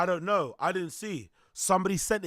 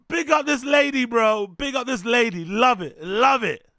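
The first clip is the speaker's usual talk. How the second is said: loud, high hyped shout-out; big up her, loud; excited; 6 semitones higher, 8 dB louder than usual. Real, not acted.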